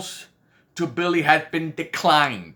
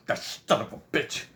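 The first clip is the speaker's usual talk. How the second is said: hushed, breathy curse under the breath; whispered angry curse from the husband; muttered curse; 4 semitones lower, 7 dB quieter than usual. Retold or imitated. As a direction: spit it out in a whisper. Dave reacting to news.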